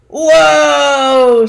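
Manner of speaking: long loud surprised cry while flying; startled whoa. Acted. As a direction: yelp as the rope yanks you off your feet. Flung through the air on rope.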